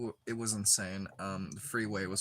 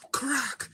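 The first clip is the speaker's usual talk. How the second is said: high, loud cracking sound effect; sudden sharp crack, acted out; imitated bone crack; 5 dB louder than usual. Joker mimicking two bones colliding, explosive crack.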